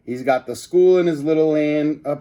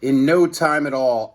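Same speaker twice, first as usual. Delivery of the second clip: ordinary delivery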